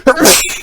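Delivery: sudden sharp real sneeze; sneezing. Real, not acted. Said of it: "Sorry about the sneeze".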